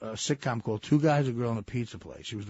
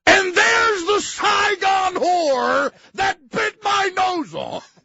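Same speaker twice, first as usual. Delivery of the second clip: high slow yelled outrage; mock shout; 6 dB louder, much slower than usual. Farley's character spotting her on TV, yelling slowly.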